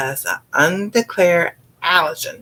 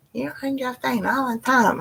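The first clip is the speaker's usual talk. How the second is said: son's complaint; 6 semitones higher, 4 dB quieter than usual. Retold or imitated. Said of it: "My son goes".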